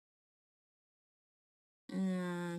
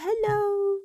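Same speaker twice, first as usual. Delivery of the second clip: bright high hello; 11 semitones higher, 6 dB louder than usual. Real, not acted. Cheery greeting to viewers.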